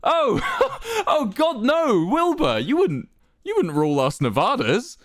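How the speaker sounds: high, loud laughing disbelief; chuckling. A narrator's reading. Quackity laughing off Wilbur's misunderstanding, amused chuckle. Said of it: "he chuckled".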